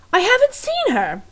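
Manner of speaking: high, loud indignant shout; Louise shouting. Acted. Louise yelling at the cook, high angry shout. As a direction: bellow a flat denial. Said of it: "Louise was shouting".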